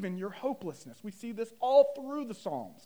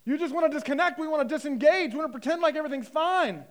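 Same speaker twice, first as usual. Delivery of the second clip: high, loud, driving sermon delivery; forceful preaching; 8 semitones higher, 7 dB louder, faster than usual. Real, not acted. Preacher confronting listeners about pretending all is fine, forceful. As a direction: press it hard on the congregation.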